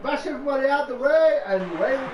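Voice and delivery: loud, high, thrilled yell; excited shout. Real, not acted. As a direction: shout it out with glee. Race start, cheering.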